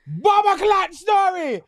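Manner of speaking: high, loud playful shout. Real, not acted. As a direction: call it out teasingly.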